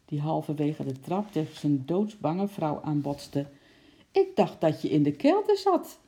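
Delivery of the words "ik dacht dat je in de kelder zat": high, puzzled exclamation; surprised exclamation. A narrator's reading. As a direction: exclaim in surprise to your wife. Cook startled by panicking wife. (verbaasd uitroepend)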